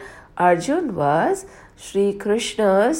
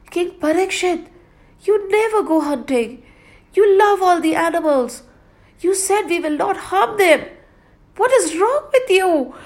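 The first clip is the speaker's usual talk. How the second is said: high, louder alarmed voice; pleading guards; 11 semitones higher, 4 dB louder than usual. Acted. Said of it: "the god said".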